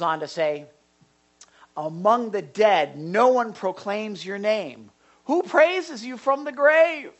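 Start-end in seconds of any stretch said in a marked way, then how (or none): 1.8-7.1 slow plea climbing to a high cry. Acted. Preacher voicing David's psalm to God, crying out. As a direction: build from measured lament to impassioned question. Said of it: "I can hear him kind of crying out".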